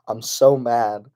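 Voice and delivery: ordinary talk, nothing marked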